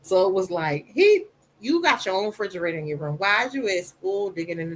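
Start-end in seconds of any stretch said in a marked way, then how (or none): none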